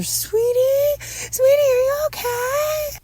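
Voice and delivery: very high, wailing call through a door; worried mother calling. A narrator's reading. Mother calls to son behind door, high worried wail. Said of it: "From behind his door, we can hear Patricia".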